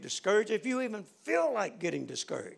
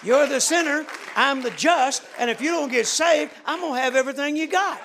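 louder, punchy, a bit higher; bold retold challenge; 6 semitones higher, 7 dB louder than usual. Preacher telling Bob off, loud and triumphant.

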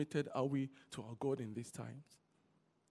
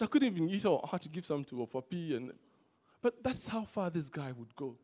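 normal talking voice

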